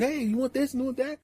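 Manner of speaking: put-on high, eager kid voice; little kid voice. A little boy offers food to the crowd, high and eager. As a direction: chirp it like a friendly kid offering snacks.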